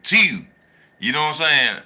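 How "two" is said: "Two." drawn out the Baltimore way; imitating a Baltimore "Two."; Snoop's Baltimore "two". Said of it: "how Snoop used to always say her words like".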